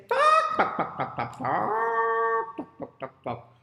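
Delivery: loud high squawk dropping into quick clucks; clucking hen. Acted. Miss Hen calls out over her eggs, squawking and clucking. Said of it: "And she says".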